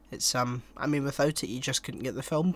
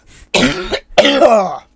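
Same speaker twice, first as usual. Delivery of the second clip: very loud, high throaty roar; long rasping creature roar in play; mock dinosaur roar; 9 semitones higher, 16 dB louder than usual. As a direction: bellow like a dying dinosaur, hammed-up. Dinosaur roar, then jokes he's dying.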